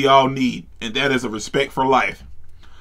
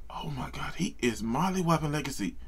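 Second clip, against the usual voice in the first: hushed breathy whisper, much softer; awed whisper; 13 dB quieter than usual. Real, not acted. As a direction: whisper in disbelief. Stunned by brutal beating.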